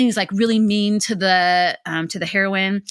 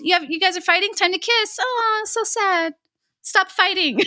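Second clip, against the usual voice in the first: high mock-sweet mom voice; hammed-up high mommy pity; teasing mom; 10 semitones higher than usual. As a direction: coo at squabbling kids, drawing it out. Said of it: "I do that with my kids".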